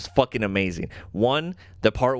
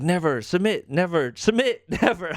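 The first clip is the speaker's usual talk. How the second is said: higher-pitched mock standoff; defiant back-and-forth; 7 semitones higher than usual. Host acts out fighters demanding surrender from the Watcher, higher mock defiance. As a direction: trade demand and stubborn refusal.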